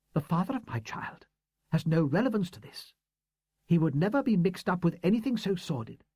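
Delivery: a hint of a proud, hurt old teacher; woman's voice. A narrator's reading. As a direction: read her as a composed, defensive schoolteacher. Woman's voice in the story.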